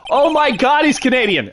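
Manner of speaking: high, loud delighted outburst; excited high exclamation; real excited discovery. Real, not acted. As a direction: exclaim it like a thrilling find. Delighted discovery about a character.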